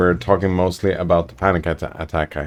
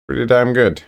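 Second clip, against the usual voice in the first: higher, punchy pretty damn good; emphatic praise; 6 semitones higher than usual. Real, not acted. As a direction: sell it like a real compliment. Upgraded praise after plain take.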